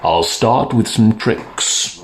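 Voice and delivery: a lightly voiced magician. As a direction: magician starting his act.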